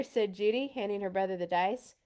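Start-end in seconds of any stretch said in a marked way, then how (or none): none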